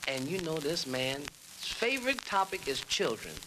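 usual speaking voice

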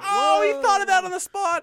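high, loud impressed mockery; high mock crowd voice. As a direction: squeal it like a dazzled audience. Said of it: "Everyone goes".

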